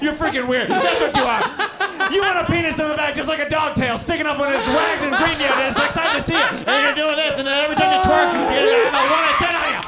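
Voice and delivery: high, loud, fast hammed-up rant; mock outrage. Mocking his friend's rant back at him, high and loud. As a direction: rant at him like he's disgusting. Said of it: "and then you're like".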